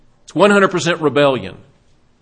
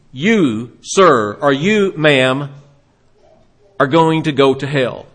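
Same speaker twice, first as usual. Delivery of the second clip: low, deliberate pronouncement of doom; single people out word by word; lightly acted voice of judgment; divine sentence; 3 semitones lower, slower than usual.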